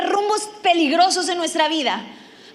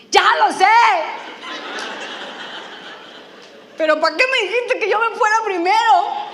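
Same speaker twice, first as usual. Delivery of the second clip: exasperated retort; 6 semitones higher than usual. Retold or imitated. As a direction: snap back, laughing. She retorts to the pastor.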